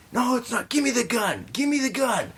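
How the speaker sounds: very high mock-frantic demand; ranting, freaking-out man. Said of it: "this guy is just ranting".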